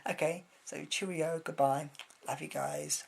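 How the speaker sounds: ordinary talk, nothing marked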